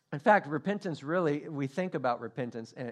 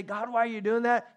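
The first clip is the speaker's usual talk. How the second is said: higher, exasperated question; pleading complaint; 7 semitones higher, 4 dB louder than usual. Acted. Man as cat turns to ask God why, higher exasperated plea. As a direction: demand an explanation, voice raised.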